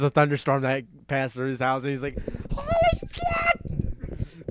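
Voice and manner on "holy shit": very high, drawn-out shriek; mock panicked scream. Scared friend reacting to a thunderstorm, mock shriek. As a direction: imitate a terrified scream, stretched out.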